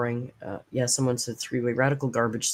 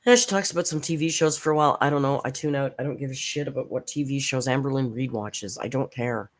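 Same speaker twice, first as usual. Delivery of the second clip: normal talking voice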